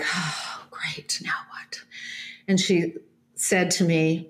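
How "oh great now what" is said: a soft unvoiced whisper, resigned and wary; whispered dread. As the flight attendant sits down next to her, whispered resigned dread. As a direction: whisper it under your breath, bracing for trouble. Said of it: "kinda like".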